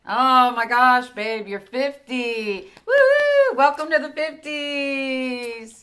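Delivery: high, loud, slow celebration; excited. Real, not acted. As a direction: cheer him into his fifties. Celebrating husband's fiftieth.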